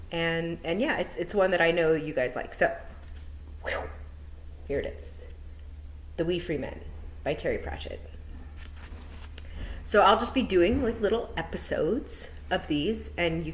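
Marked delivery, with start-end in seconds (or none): none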